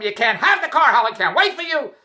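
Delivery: high, loud mock yell; hammed-up angry refusal; hollering. Wife hollering down the phone about the car, loud. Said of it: "she starts hollering".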